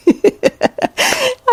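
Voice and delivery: high squeaky laugh; high laughing fit. Real, not acted. Mom cracking up about her daughter's reaction, high giggle.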